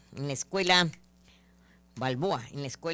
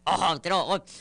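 ordinary delivery

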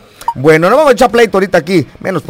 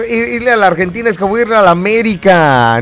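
usual speaking voice

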